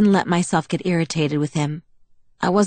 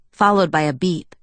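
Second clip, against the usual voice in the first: ordinary delivery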